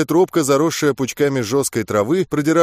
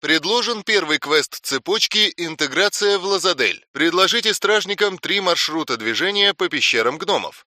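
higher, even game notification voice; system message read; 4 semitones higher than usual. Acted. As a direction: announce the quest like a game UI.

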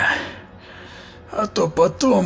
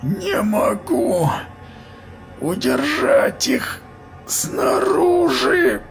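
high, slow crying plea; agonised cry; 13 semitones higher, much slower than usual. A narrator's reading. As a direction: cry out in agony. Giant losing control.

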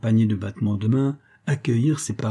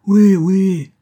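high, very loud agreement; crowd chorus; 8 semitones higher, 10 dB louder than usual. A narrator's reading. Guests all back the accusation, loud crowd chorus. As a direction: shout it as a whole roomful. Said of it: "répéta-t-on en chœur".

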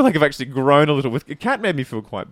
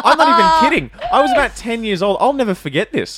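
high, loud, laughing disbelief; laughing horror; 9 semitones higher, 7 dB louder than usual. Real, not acted. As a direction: recall it half laughing, half appalled. Laughing, horrified childhood memory.

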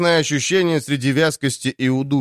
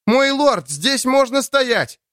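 shouting; 12 semitones higher, slower than usual. Acted. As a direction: shout it out, straining to be heard. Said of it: "едва слышно кричал охотник".

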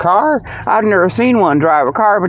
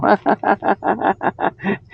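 long, high, softer chuckle; amused cackle; 4 semitones higher, 5 dB quieter than usual. Real, not acted. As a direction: giggle at your own mock ad. Laughs at his mock ad.

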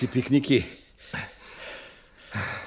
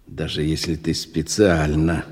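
usual speaking voice

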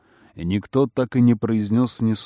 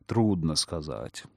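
low, soft, slow evasion; evasive; 8 semitones lower, 7 dB quieter, slower than usual. A narrator's reading. Feiyu dodging the guests' question, softly evasive. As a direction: answer evasively, dropped low and quiet. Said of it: "уклончиво ответил Фэйю".

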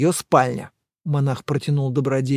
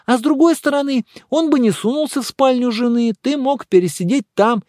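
higher-pitched, speculating friend; lively, higher character voice; animated friend; 9 semitones higher, 3 dB louder than usual. Acted. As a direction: voice an excitable friend in a raised pitch. Voices Добродеев in dialogue.

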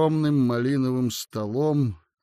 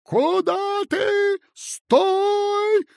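very high, slow guard's yell; piercing high cry of a teenage guard; terrible shout; 5 dB louder, much slower than usual. Acted. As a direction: shriek at the intruder to stop. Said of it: "страшно закричал".

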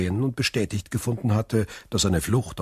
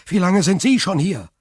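"Wie lange sind Sie schon hier?" much higher and louder, falling on Sie; eager urgent question; 11 semitones higher, 7 dB louder than usual. A narrator's reading. Young prisoner eagerly asks the stranger back, high and loud. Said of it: "Ich bin unschuldig".

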